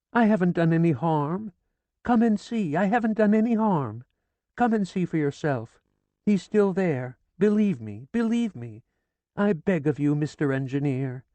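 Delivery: high, loud, anxious pleading; desperate pleading. A narrator's reading. Kneeling widow pleading with the sergeant, desperate. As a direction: plead on your knees.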